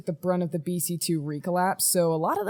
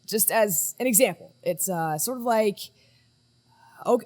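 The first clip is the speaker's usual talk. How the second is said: normal talking voice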